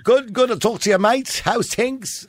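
high-pitched put-on Scouse greeting; badly faked Liverpool accent; attempted Liverpool accent. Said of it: "constantly trying to do that accent".

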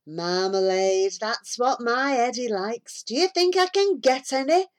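a hint of a fussy housewife, rising high at the question; lightly voiced housewife, going high; voiced village housewife. A narrator's reading. Housewife asking for marmalade, lightly voiced housewife.

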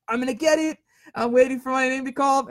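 high-pitched mock excitement; giddy anticipation. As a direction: squeal it playfully, sure you're about to win. Acts out eager wait to win.

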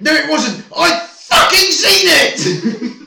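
furious yelled denial, voice up high; irate shout. Retold or imitated. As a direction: shout it like a man sure he saw it. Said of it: "And he's like".

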